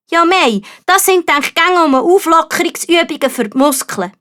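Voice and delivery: babbling excuses, flustered; flustered knight. A narrator's reading. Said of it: "erklärte Sascha". (hoher, verlegener Ritter)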